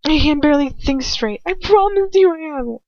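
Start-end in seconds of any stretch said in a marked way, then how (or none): 1.5-2.8 high, loud pleading; desperate plea. A narrator's reading. Captive pleading with Draco, desperate and loud. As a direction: beg to be believed.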